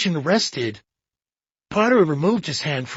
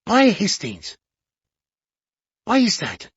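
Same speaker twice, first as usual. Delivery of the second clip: quick eager questions, pitched up; lightly excited little detective; 5 semitones higher than usual. A narrator's reading. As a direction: press him, keen to know. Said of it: "He spoke excitedly".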